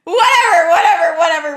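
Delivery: amused wave-off; laughing dismissal. Real, not acted. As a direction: laugh it away. Waves it off laughing.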